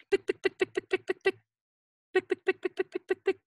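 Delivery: fast high "pick" clicks; running heels. Shown as a demonstration. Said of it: "The lady starts to run".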